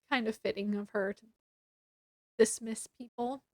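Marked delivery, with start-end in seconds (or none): none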